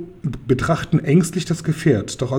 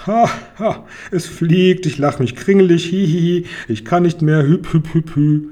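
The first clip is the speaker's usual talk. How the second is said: pharaoh cackling with laughter mid-speech; laughing fit; 4 semitones higher than usual. A narrator's reading. Pharaoh mocking dragon's flying machine, laughing helplessly. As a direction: laugh it out helplessly, gasping hüp hüp. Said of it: "bricht der Pharao in schallendes Gelächter aus".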